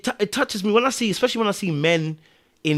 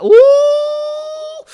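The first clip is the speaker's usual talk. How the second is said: very high, loud drawn-out ooh; mock overwhelmed wail; 7 dB louder than usual. Shown as a demonstration. Showing how the tear falls, high mock-crying ooh. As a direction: act out a tear breaking you with a high wail. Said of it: "the tear is just going like that".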